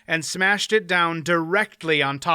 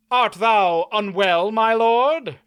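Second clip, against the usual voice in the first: a knight's courtly concern, raised and full; solicitous knight; 7 semitones higher, 6 dB louder, slower than usual. A narrator's reading. Knight asks the fallen baron if he is unwell, solicitous. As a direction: ask after the fallen baron with mock courtesy. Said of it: "inquired solicitously".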